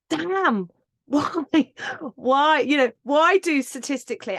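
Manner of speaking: high, exasperated rant at the unfairness; angry outburst. Retold or imitated. Telling listeners how the pay gap made her angry, exasperated outburst. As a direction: let the frustration burst out. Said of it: "It made me angry".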